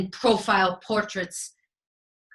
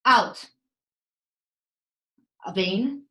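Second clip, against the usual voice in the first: ordinary delivery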